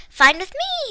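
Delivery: cheery agreement; cheerful. A narrator's reading. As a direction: sound happy to go. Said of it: "he said cheerfully".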